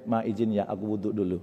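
soft, low request; gentle, subdued asking; polite husband. Retold or imitated. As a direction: excuse yourself quietly to your wife. Husband politely excusing himself to wife.

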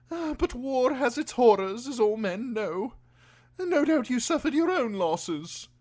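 high-pitched, oblivious highborn lord; Lord Alistair. A narrator's reading. Lord chats on obliviously to Davos about war, lofty. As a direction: hold forth in a high, loud aristocratic voice.

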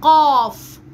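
loud, drawn-out "قاف" with a heavy q; emphatic qaf. Shown as a demonstration. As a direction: model "قاف" from the back of the tongue. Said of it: "في القاف مفخم شوية".

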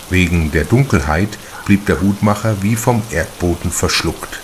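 ordinary delivery

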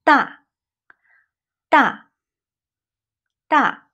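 大 falling from high. Shown as a demonstration. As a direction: drop 大 down firmly. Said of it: "Falling tone".

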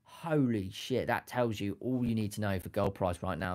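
normal talking voice

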